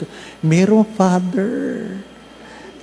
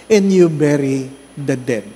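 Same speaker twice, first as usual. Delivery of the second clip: ordinary talk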